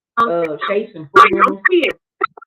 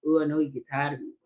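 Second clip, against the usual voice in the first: softer, murmured plea to the Lord; quiet prayer; 4 semitones lower, 7 dB quieter than usual. Retold or imitated. Talking to God in traffic, murmured softly. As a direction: mutter it as a tired little prayer. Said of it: "I say".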